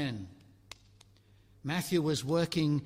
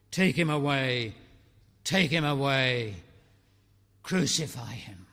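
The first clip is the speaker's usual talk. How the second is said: mob shout; 4 dB louder than usual. Retold or imitated. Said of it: "And they shouted".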